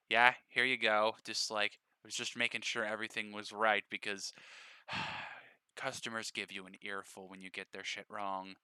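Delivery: low, soft-spoken bartender figure; Milton. Acted. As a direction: speak low and quietly, trailing to a sighing murmur. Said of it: "Milton will come back just like".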